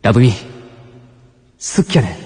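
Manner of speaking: drawn-out Kansai confession, leaping high on the repeat; Kansai love confession. Acted. Senpai declares love to Labumi, heartfelt Kansai dialect. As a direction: confess in Kansai dialect with long charged pauses. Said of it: "関西弁を使った告白のストーリー".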